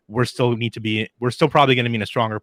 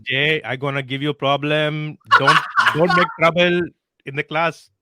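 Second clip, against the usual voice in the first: put-on higher accented teacher scolding a pupil; strict English teacher; 5 semitones higher than usual. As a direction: scold the class like a foreign teacher. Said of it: "That's exactly it".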